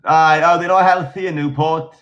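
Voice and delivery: ordinary talk, nothing marked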